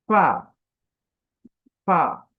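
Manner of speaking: ফা said loud with teeth on lip; crisp fa. Shown as a demonstration. Teacher demonstrating the letter to students, loud. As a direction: bite the lip and puff ফা. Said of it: "সামনের উপরের দাঁত".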